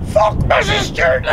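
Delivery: high, loud, drawn-out shout; defiant yell. Retold or imitated. Angry boy roaring about the neighbour, loud and high. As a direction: bellow it in defiance. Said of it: "The next thing was".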